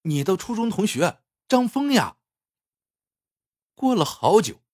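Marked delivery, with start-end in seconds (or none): 0.0-2.2 high-pitched, eager reminder; anxious to be remembered; hurried explanation. A narrator's reading. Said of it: "张峰赶紧解释".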